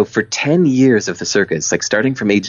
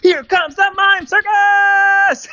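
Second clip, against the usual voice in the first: hammed-up barker, much higher, building to a shout; over-the-top carnival barker; 14 semitones higher than usual. Ringleader finally hamming it up to the circus crowd, over-the-top barker. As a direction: sell it like a ridiculous carnival barker. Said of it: "acted like a carnival barker".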